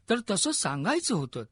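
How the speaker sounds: understated grief, rising in intensity; tearful reproach. A narrator's reading. King to his dying mentor about Swarajya, tearful reproach. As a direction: reproach him with a touch of tears. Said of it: "रडत राजांनी दादोजींच्या छातीवर मस्तक ठेवलं".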